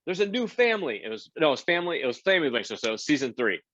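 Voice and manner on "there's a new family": high, hurried backstage warning; urgent producer. Retold or imitated. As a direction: warn the host in a rush. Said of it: "he goes".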